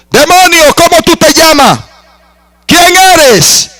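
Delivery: high, forceful call to the demon; pitched high, calling out the demon's name; commanding the demon. Retold or imitated. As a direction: demand its name like an exorcist. Preacher confronts demon, retold.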